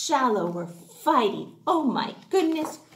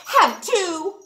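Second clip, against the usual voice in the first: higher, louder have too; childish retort; 6 semitones higher, 4 dB louder than usual. Acted. Crab answering seahorse with a petty retort. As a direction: shoot it right back. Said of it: "Clip replied".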